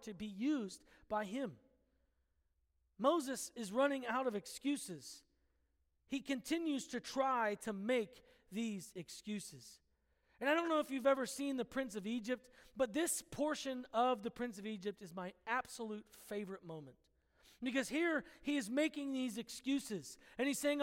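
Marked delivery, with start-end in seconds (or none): none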